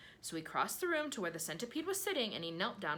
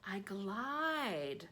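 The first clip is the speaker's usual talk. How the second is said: prim, put-on earthworm voice; primly; 3 semitones higher than usual. A narrator's reading. Earthworm primly corrects the centipede. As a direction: say it with prim dignity. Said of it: "said the earthworm primly".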